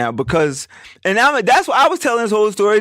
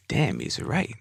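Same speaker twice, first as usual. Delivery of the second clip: quiet realization; 5 semitones lower, 9 dB quieter, slower than usual. Retold or imitated. Quiet realization he was right.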